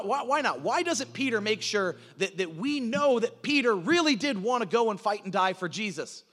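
ordinary delivery

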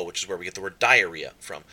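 ordinary talk

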